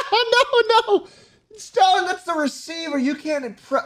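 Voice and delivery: high helpless laughing through the words; laughing too hard to get it out; real laughter. Real, not acted. Gamer laughing at Stalin holding the phone backwards, real laughter. Avatar holds phone wrong.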